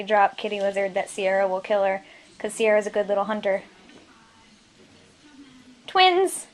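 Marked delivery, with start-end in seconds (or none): 5.9-6.5 high, loud burst of delight; delighted exclaim. Real, not acted. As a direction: squeal at the pair. Delighted at pets together.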